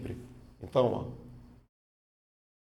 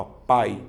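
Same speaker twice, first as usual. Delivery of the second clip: "pai" loud on the A, lower, the i weak; strong A, weak I; 4 semitones lower, 5 dB louder than usual. Shown as a demonstration. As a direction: stress the A of "pai" and let the i glide off. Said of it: "ela tem um som mais fraco".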